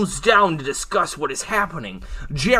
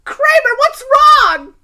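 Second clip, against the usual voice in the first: very high, loud cry; panicked shriek; 11 dB louder, slower than usual. Acted. Velma pleads with the attacking Kramer, shrieking in panic. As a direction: shriek it in alarm.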